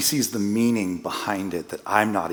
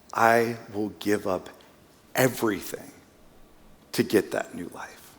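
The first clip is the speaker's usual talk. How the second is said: retold tearful vow. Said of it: "the snotty crying".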